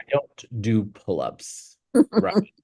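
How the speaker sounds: slow, drawn-out I don't do pull-ups; incredulous refusal. Retold or imitated. Refusing the trainer's pull-ups with a slow balk. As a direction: balk at the idea.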